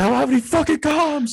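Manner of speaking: high-pitched, exasperated imitation; mock mad outburst. Friend mimicking a teammate with dead comms, mock fury. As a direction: complain far up high, hammed-up fury. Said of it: "He's so mad".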